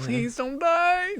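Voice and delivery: high, loud, drawn-out plea; mock pleading. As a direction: wail it out slowly in playful desperation. Acted plea to character at cliffhanger.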